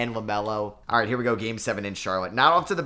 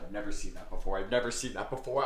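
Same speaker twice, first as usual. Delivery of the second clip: ordinary delivery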